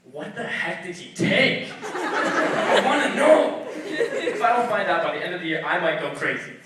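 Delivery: loud, drawn-out demand to know; exasperated outburst. Acted. Robbed family baffled by what was taken, loud exasperation. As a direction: build from slow disbelief to a loud mock rant.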